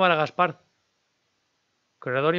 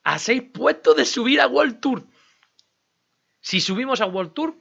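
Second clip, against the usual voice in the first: higher and louder, thrilled; excited; 8 semitones higher, 3 dB louder than usual. Real, not acted. Streamer telling viewers the team nears World Tour, excited. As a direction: announce the big news with real excitement.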